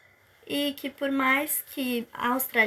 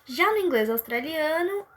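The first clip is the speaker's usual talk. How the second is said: ordinary talk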